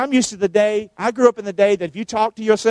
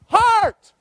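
high exclaimed answer. Real, not acted. Congregation answers, pastor shouts the word.

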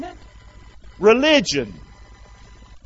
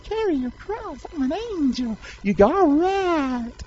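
angel voice; 10 semitones higher, slower than usual. Acted. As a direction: pipe it up high like a visiting angel. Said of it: "some angels come to our house and said".

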